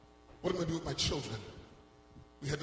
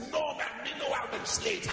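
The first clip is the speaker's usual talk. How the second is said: higher, put-on Jamaican accent; joking denial in a higher Jamaican accent; 6 semitones higher than usual. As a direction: fake a Jamaican accent to dodge being recognised. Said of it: "I wanted to use a Jamaican accent".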